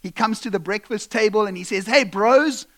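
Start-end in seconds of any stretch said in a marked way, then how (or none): 1.8-2.7 loud, bright hey bros; cheery greeting. Retold or imitated. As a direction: call out to the brothers cheerfully. Joseph greets brothers loudly.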